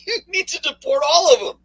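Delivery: high-pitched, laughing through the words. Real, not acted. Host scoffing with co-host at lawbreaker logic, laughing. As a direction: break into laughter mid-sentence.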